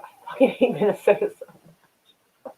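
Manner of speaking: slow rant broken up by laughter; laughing while ranting. Real, not acted. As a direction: crack up halfway through the complaint. Breaks up over own rant.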